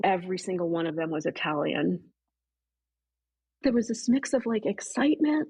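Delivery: ordinary talk, nothing marked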